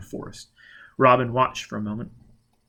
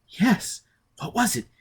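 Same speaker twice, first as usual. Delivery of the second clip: higher, frightened woman's voice; trembling and unsure; shaken Robin; 10 semitones higher than usual. Acted. Robin answering Foyle, voiced higher and frightened.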